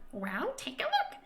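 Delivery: high, slow squeaky invitation; a sprite showing off the view; tiny creature. Acted. Creature gestures at village.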